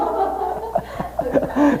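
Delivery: laughing at his own joke, high and breathy; high burst of laughter. Real, not acted. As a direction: let a high laugh spill out. After son's line.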